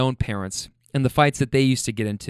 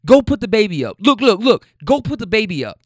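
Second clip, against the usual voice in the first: higher, louder mock angry man; imitating him yelling; 7 semitones higher, 4 dB louder than usual. Boyfriend telling girlfriend to put the baby up, mock yelling. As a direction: play back his angry orders. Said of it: "He's like".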